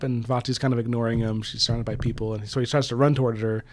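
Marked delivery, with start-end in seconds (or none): none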